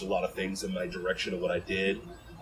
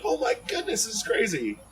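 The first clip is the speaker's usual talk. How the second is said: amazed; 13 semitones higher than usual. Retold or imitated. Retold disbelief after big throw.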